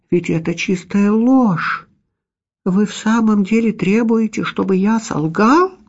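high, slowed disbelief; astonished. A narrator's reading. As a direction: ask it in shocked disbelief. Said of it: "выказывая все признаки крайнего удивления".

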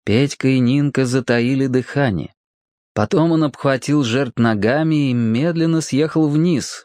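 plain reading voice

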